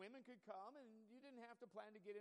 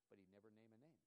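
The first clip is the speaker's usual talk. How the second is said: ordinary talk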